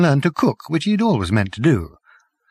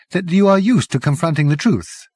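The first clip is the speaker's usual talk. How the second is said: ordinary delivery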